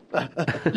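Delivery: quiet disbelieving chuckle; a brief hushed laugh; short soft laugh. Acted. (ضحكة خافتة قصيرة)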